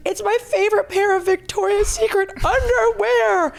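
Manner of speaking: high whiny wail over underwear; imitating a sobbing friend, pitched high; mock bawling. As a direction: whimper it like a crying friend. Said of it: "She starts to bawl. She goes".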